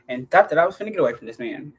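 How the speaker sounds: ordinary talk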